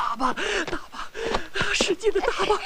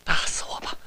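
whispered defiance; 3 dB quieter, faster than usual. Acted. Mock heroic defiance.

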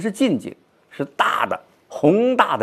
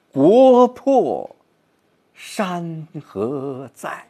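国破重读，山河在放慢; 国破 loud, 山河在 slowed; 6 semitones higher, 4 dB louder, much slower than usual. Shown as a demonstration. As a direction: punch 国破, slow down 山河在 with pain. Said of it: "是放慢的".

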